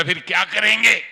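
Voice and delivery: बहुत ऊँची, तेज़ और ज़ोरदार चीख़; झल्लाकर पूरी ताक़त से पूछो; ज़ोरदार चिल्लाहट. Retold or imitated. भड़ककर दीदी से सवाल. (दीदी पर भड़ककर, ऊँची आवाज़ में तेज़ चीख़)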